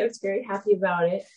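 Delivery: normal talking voice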